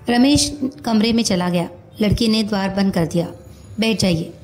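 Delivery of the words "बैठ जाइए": ordinary delivery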